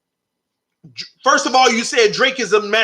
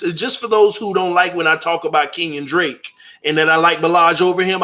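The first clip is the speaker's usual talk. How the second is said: ordinary talk, nothing marked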